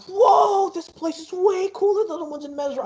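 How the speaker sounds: high-pitched wide-eyed wonder; excited kid. Acted. Yamu seeing the park for the first time, excited. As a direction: play a child thrilled by the park.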